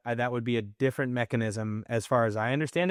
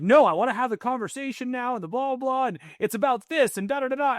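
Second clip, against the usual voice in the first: much higher mock argument voice; insistent rant, pitched way up, running into blah-blahs; worked-up protest; 12 semitones higher, 3 dB louder than usual. Husband insisting to wife on having the conversation, worked-up.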